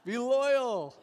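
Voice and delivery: loud, high call with a falling end; emphatic proclamation. Shown as a demonstration. Preacher giving the congregation the chapter's emphasis, loud proclamation. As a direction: proclaim it to the whole room. Echoes shouted answer to congregation.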